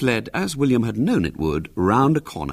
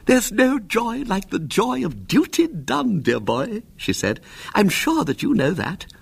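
put-on high, fluttery old lady voice; a fussy aunt lecturing her nephew; prim elderly aunt; 13 semitones higher than usual. A narrator's reading. Fussy aunt praising duty to William, high and fluttery.

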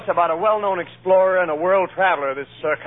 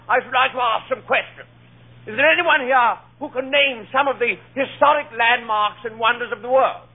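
lightly voiced lecturer, raised pitch; lecturing explorer; 5 semitones higher than usual. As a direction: address the ladies' club as a world traveler, a little higher. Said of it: "he's talking at a ladies' club".

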